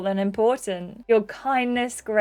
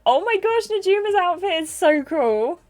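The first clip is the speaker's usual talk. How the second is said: higher-pitched, gushing party guest; lightly voiced excited game character; lively toast-maker; 8 semitones higher, 3 dB louder than usual. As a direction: propose a toast, then gush in a higher voice.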